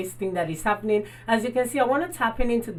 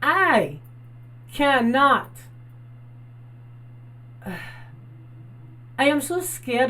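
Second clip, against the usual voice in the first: exasperated; 5 semitones higher, slower than usual. Real, not acted. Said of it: "I suffer from P T S D".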